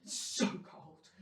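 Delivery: slow, breathy whisper from the cold. Real, not acted. In freezing water.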